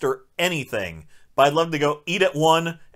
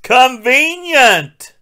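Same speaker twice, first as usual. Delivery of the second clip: high, loud, drawn-out exclamation; mock exclamation; 10 semitones higher, 7 dB louder, much slower than usual. Real, not acted. Reviewer jeers at a plot turn, mock exclamation. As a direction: stretch it out loud and high in mockery.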